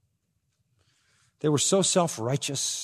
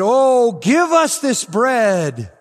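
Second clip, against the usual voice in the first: pleading crowd; 11 semitones higher, 10 dB louder, much slower than usual. Acted. Crowd begging for bread, acted.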